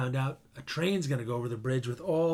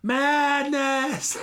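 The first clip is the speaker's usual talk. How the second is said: much higher and louder, full-throated cry; second cry of madness, at full pitch; anguished shout; 10 semitones higher, 6 dB louder than usual. Retold or imitated. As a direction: scream it at the ruins in horror. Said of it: "he's looking over everything going".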